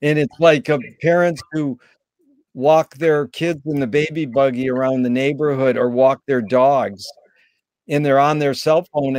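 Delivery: normal talking voice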